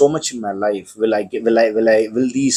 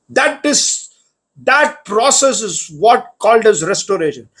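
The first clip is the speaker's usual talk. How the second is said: full-force preaching; 9 semitones higher than usual. Real, not acted. Preacher urging his congregation that God restores, in full-force preaching. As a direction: preach it out with rising fervour to the whole church.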